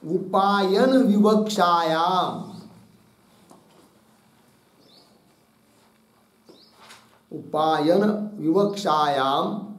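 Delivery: slow, phrase-by-phrase dictation with each phrase repeated. Shown as a demonstration. Teacher dictating a note to his students, slow and deliberate. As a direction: dictate so students can write every word.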